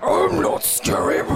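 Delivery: slow, loud rasping monster growl; scary monster voice. Retold or imitated. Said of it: "doing a scary monster voice".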